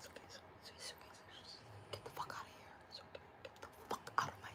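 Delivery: barely audible muttering under the breath; whispered aside. Retold or imitated. Owner soothing customer while wanting them gone, whispered aside. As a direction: whisper reassurance, then curse in secret.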